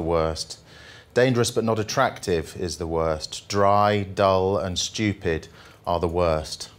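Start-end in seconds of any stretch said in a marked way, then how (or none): none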